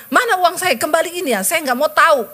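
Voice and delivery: high, insistent demand for the money; high, insistent creditor demand. Retold or imitated. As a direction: push hard, like a creditor who won't wait. Angry message demanding money back.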